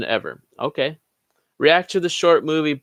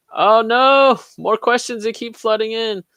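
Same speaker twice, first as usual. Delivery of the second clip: high, drawn-out "oh no" whine; mock dismay; 9 semitones higher, 4 dB louder than usual. Host to chat as questions pile up, mock dismay. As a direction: play up being swamped, pitched high.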